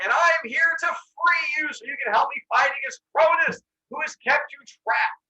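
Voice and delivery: high, loud rallying call; heroic proclamation. Retold or imitated. Zeus offers to free those trapped by Cronus, loud proclamation. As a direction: proclaim it to a whole crowd.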